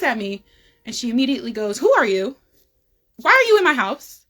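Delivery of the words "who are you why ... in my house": sharp angry demand, louder and higher; retold hostile yell, high and loud. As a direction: imitate an angry mom catching a stranger in her house. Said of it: "just straight hostility and yelling".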